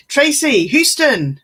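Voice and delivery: calling out. Real, not acted. Calling out a name.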